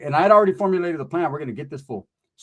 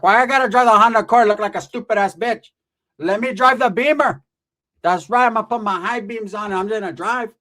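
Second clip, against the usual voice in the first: tia's voice; 6 semitones higher, 4 dB louder than usual. Retold or imitated. Aunt voiced in retold story.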